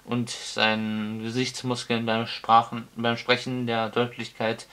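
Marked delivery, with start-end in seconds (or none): none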